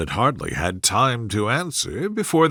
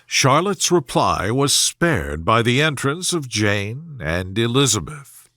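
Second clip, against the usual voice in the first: ordinary delivery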